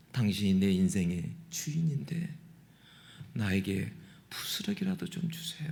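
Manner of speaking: hushed, begging near-whisper; woman's humble plea. Acted. The Syrophoenician woman begging Jesus in a hushed plea. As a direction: plead softly for crumbs. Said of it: "그 여인이 뭐라고 합니까".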